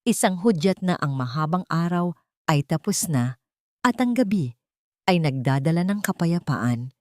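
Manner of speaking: plain reading voice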